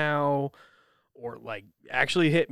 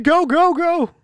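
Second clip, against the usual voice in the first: high, loud shouted go; mock yell; 12 dB louder, slower than usual. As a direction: yell it out urgently. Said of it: "I know they said".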